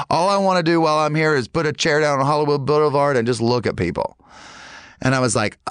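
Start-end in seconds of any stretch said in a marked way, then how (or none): 0.1-4.2 lightly put-on Southern accent; a hint of the Carolina man's accent. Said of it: "I know that accent".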